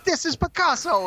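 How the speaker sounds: high-pitched, showy toy voice; mock Picasso boast. Comparing the co-host to the Toy Story scene, high mock boast. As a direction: proclaim it high, as a joke.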